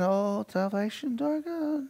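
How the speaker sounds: barely audible, half-hearted mumble; mock the timid worshipper; soft, limp mock worship; limp, quiet mock praise. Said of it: "They're not sitting back there saying".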